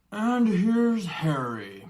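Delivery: hearty giant voice; giant's voice. Acted. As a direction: drawl it out like a friendly giant. Giant bursts in, greets Harry.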